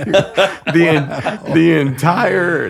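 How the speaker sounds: laughing. Real, not acted. Laughing through the climax.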